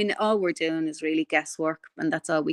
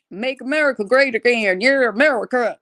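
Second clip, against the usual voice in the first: higher-pitched, hammed-up American country accent; mock a cowboy-hat American country accent; a deliberately bad impression in an American country accent; 6 semitones higher, 5 dB louder than usual. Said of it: "that was my bad attempt at".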